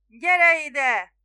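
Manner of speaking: loud, high hail; roaring shout. A narrator's reading. Spiro summoning the distant boatman, shouting. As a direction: yell for someone far off to come. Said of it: "ordított Spiro görögül".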